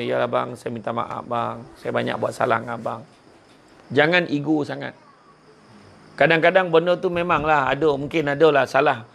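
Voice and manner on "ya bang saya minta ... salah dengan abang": soft apology. Retold or imitated. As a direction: lower and softer, humbly saying sorry. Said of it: "tahu pegang tangan suami dia".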